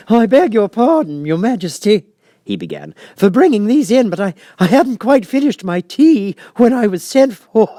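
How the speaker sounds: the Hatter apologizing to the king. Acted. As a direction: play the flustered Hatter.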